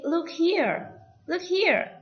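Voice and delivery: high, loud "Look here"; attention call. Shown as a demonstration. Teacher shows how to get someone's attention, high loud call.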